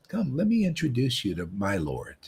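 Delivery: usual speaking voice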